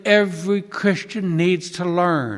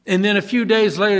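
ordinary talk

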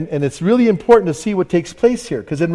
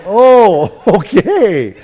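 laughing realization; 3 semitones higher, 8 dB louder, much slower than usual. Retold or imitated.

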